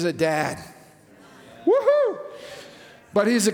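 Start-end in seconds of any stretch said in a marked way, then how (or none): 1.6-2.2 a high, loud celebratory woohoo; joyful whoop. Acted. Pastor cheering about God as a dad, joyful whoop. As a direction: whoop with delight.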